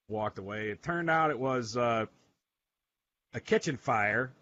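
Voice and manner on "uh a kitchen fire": ordinary talk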